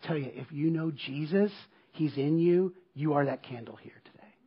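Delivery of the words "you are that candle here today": soft, low whisper of the key line; an intimate hushed sermon close. Real, not acted. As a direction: lean in and whisper it to the room. Preacher whispers the point.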